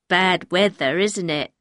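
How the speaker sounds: simple-minded man's voice; slightly dopey small talk; stupid smile. Acted. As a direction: say it with a dim smile. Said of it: "said with a stupid smile".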